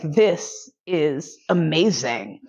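very slow, word-by-word savouring; blissful awe. Retold or imitated. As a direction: draw out each word in mock bliss. Retold blissful realisation, drawn out.

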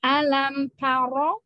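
slow "Alam taro" with a thick ro; heavy ro. Shown as a demonstration. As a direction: draw out "Alam taro" in recitation style. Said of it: "ya ro seperti itu".

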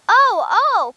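high, loud little outburst; loud, high-pitched exclamation; excited cry. A narrator's reading. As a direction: cry it out like an eager child. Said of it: "cried Benny".

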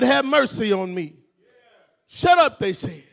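high, loud pulpit shouting; preaching at full force. Real, not acted. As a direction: drive it home to a stirred-up congregation.

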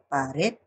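"pared" with its final "red" rising; stress on last syllable. Shown as a demonstration. Teacher repeating an example for students, "pared" stressed on "red". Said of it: "la elevación de la voz en la última sílaba".

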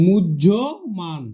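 মুহ্য মান said as "mujjhoman" with a doubled j; pronunciation demo. Shown as a demonstration. Teacher models মুহ্যমান for students. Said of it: "আমরা মান লিখে দিলাম".

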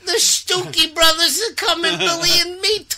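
very high, excited put-on female voice; Puerto Rican actress in bed. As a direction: squeal it as a hammed-up actress mid-romp. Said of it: "What if she said that while you're banging".

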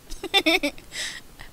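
high-pitched giggle. Real, not acted. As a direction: giggle before saying bye. Laughing at signing off.

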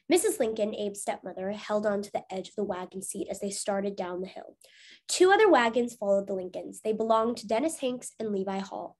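ordinary delivery, nothing marked

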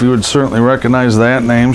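usual speaking voice

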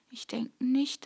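normal talking voice

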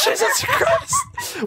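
high shrieking laugh-scream of fright; startled scream. Real, not acted. Jump-scared gamer shrieks in terror.

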